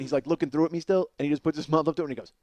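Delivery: ordinary talk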